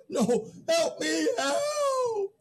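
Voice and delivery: high, desperate cry for help; panicked wail for help, pitch rising. Acted. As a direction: cry out as if drowning in fear. Man calls desperately for help.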